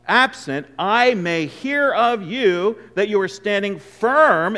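weighty pulpit reading; scripture reading. Acted. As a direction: read the verse like a proclamation. Reads scripture slowly with force.

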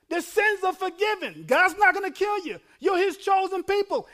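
high, loud preacher's proclamation; exhorting shout. Acted. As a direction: shout the good news to a weeping crowd. Preacher acts out Ezra reassuring crying people.

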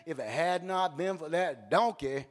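low, measured angel voice; deep deadpan angelic pronouncement. Acted. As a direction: deliver the angel's warning low and restrained. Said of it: "and the angel says".

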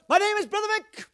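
very high, loud missionary impression; Brother Vic. Pastor imitating how the visiting missionary talked, high shouting voice. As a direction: belt it out like an excitable preacher. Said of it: "That's the way he talked".

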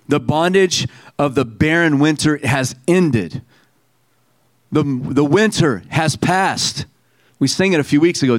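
ordinary talk, nothing marked

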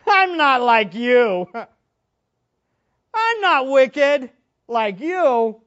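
slow, loud sneering Pharisee; mocking Pharisee. Preacher voicing the Pharisee sneering at the tax collector.

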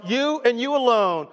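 you and you alone, each you lifted high; preacher to congregation, hitting you and you alone; stress on you. Shown as a demonstration. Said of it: "that word in you in the original text is emphatic".

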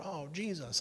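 high, hushed mock oath; mock exclamation. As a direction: imitate someone exclaiming, but keep it soft. Mimicking casual swearing by the name.

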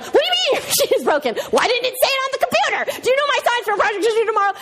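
high, fast, frustrated tirade; exasperated rant. Retold or imitated. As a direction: let it all spill out in a rush. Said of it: "I just wanna be like".

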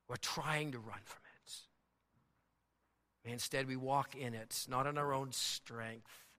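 soft, low, earnest prayer voice; closing prayer. Acted. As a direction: pray gently over the church family. Pastor leads closing prayer.

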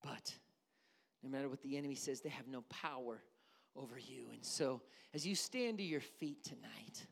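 dropped to a low, near-whispered voice; hushed preaching. Acted. Pastor closes the service to the congregation, hushed. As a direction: pull them in close with a hush.

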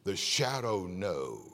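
a hint of the radio Shadow voice; echoing the Shadow. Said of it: "we used to listen".

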